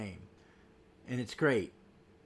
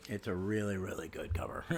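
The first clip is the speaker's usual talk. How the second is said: whisper; 6 semitones lower, 6 dB quieter than usual. Real, not acted. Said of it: "drops to a whisper about cover".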